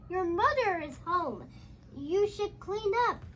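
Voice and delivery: usual speaking voice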